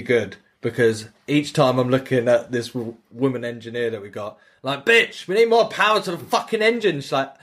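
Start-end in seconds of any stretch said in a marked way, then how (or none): 4.9-7.0 higher, hammed-up commanding yell; mock captain's bark. Captain retells yelling orders at the game's engineer, hammed-up.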